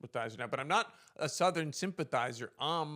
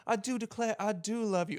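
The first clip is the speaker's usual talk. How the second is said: high, swooning Southern belle voice; a mock belle declaring her love; 8 semitones higher than usual. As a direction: gush like a lovesick belle with a high voice. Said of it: "That's the vibe".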